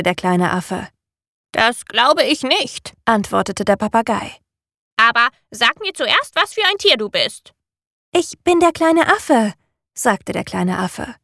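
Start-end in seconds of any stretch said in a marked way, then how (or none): 1.5-2.9 hoch, langsam und fremd klingend; Papageienstimme. A narrator's reading. Papagei antwortet. 5.0-7.5 hohe, fremde Vogelstimme; Papageienstimme. A narrator's reading. Papagei fragt den Affen. 8.1-9.5 hohe, kindliche Affenstimme; kleiner Affe. A narrator's reading. Affe stellt sich vor.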